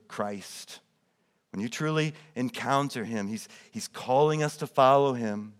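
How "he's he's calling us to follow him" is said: ordinary delivery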